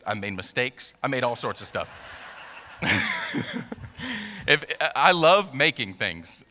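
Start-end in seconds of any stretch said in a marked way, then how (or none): 2.8-6.4 laughing. Real, not acted. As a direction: recover from a laughing fit while talking. Laughing after joke about mistakes.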